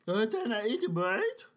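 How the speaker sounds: lightly voiced dopey zombie; goofy put-on zombie. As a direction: ask it like a sleepy zombie. Zombie character voice.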